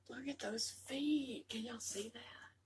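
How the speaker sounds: hushed wonder to viewers; awed whisper. Real, not acted. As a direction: whisper in awe.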